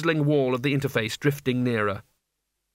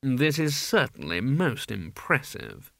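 slow, drawn-out aristocratic disdain; unhurried villainous nobleman; haughty Duke; 3 dB quieter, slower than usual. A narrator's reading. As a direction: take your time, coolly condescending. The Duke sneers at Mort.

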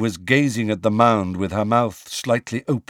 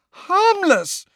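much higher, louder shrill outburst; 5 dB louder than usual. Acted. Hermione throwing Hagrid's word back at him, shrill. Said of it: "said Hermione shrilly".

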